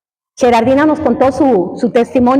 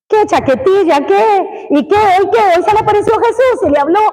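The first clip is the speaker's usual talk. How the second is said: higher teasing jeer, faster at the end; mocking classmate; 7 semitones higher than usual. Preacher imitating a classmate mocking his faith, higher and quick. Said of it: "Así me decía".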